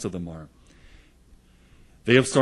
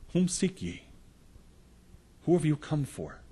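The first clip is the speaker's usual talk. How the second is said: higher, softer voice of Jesus, a touch set apart; Jesus questioning the crowd; 6 semitones higher, 4 dB quieter than usual. Acted. Jesus asking the arresting crowd whom they seek, softly. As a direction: ask it gently from above the crowd.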